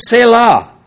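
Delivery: loud, high proclamation; exclaimed quote. Retold or imitated. Preacher quoting the end of the song, exclaimed. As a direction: call it out like a refrain.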